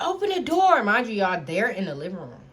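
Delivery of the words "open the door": yelling to be let in. Retold or imitated. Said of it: "I'm like".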